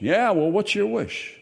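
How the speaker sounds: high, brusque woman's voice; impatient lady fish. A narrator's reading. Fish statue pressing the girl for her wish, high and brusque. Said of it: "It was a woman's voice".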